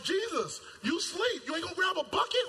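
high, fast, loud begging; frantic plea. Acted. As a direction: pester him to grab a bucket. Disciples begging sleeping Jesus.

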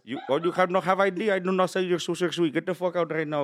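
high, indignant mock Indian accent; 7-Eleven clerk. Shown as a demonstration. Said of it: "I got seven eleven down".

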